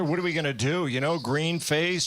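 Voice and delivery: normal talking voice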